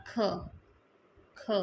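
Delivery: ख as an aspirated "kh" with a short vowel; middle sound. Shown as a demonstration. Teacher giving the middle sound to learners, sound demonstration. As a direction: say ख as an airy "kh-uh". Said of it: "middle sound भी होता है".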